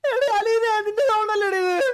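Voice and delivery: high, agitated shout at a teammate; loud accusation. Real, not acted. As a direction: yell it accusingly, pitch right up. Accuses teammate over scary sound.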